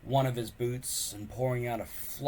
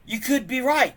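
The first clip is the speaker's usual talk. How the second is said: louder, higher, eager; 13 semitones higher, 10 dB louder than usual. Acted. Boy grabbing at an excuse from his friend, eager. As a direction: jump at the hope. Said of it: "he said eagerly".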